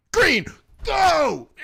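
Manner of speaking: high, loud shout; explosive race start. Acted. Two drivers at the light turning green, explosive race start. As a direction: burst out suddenly like a starting signal.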